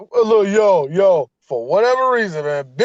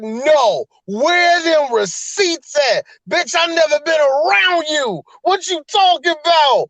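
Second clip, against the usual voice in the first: higher, indignant mock denial; mocking Jordan Devlin; 9 semitones higher than usual. Retelling Devlin brushing off his accuser, indignant mock voice. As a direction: toss back a cocky challenge for receipts. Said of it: "he was like".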